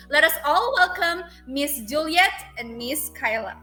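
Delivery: normal talking voice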